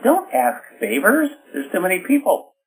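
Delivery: commanding. Shown as a demonstration. Host directing a crowded feast, firm and commanding. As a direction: bark it like the host running a crowded holiday feast.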